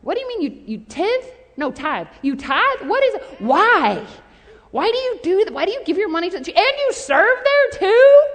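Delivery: rising, swooping disbelief that builds to loud and high; mock incredulous. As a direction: play a baffled sceptic firing questions. Acting out incredulous doubters.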